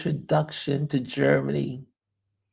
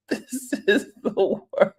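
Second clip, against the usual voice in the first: laughing through the words; can't hold the laugh; giggling repeat; 8 semitones higher than usual. Real, not acted.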